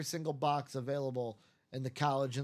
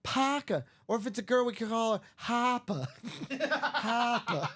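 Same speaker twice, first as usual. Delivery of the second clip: higher Boston wife, Harper with no r; Boston accent; 6 semitones higher than usual. Retold or imitated. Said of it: "the wife with the thick Boston accent".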